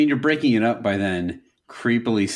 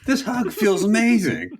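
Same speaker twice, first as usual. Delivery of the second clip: dreamy swoon; 11 semitones higher than usual. Retold or imitated. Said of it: "she's just".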